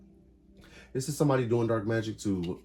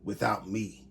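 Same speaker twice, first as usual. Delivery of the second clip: mock angry ex; 2 semitones higher, 3 dB louder than usual. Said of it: "They could be really upset".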